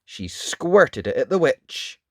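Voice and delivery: ordinary delivery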